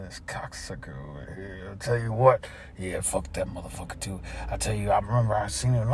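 low, quiet cursing; resentful muttering. Acted. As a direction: grumble it so he won't hear. Grumbling once coworker is past.

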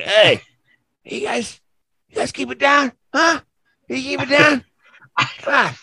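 whiny dad telling us to keep it down; complaining dad. Retold or imitated. Dad at 1:30 am asking them to keep it down, high whiny voice. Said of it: "He's like".

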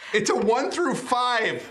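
tense and eager; hoping for the roll; excited. Real, not acted.

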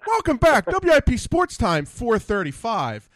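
high, projected on-air welcome; radio host welcome. Retold or imitated. Host back from break as if nothing happened, upbeat announcer. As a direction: switch into sports radio host, slowing on the time. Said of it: "Like you had no idea".

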